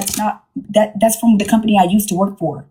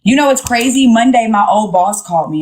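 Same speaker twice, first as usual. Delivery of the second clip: ordinary talk, nothing marked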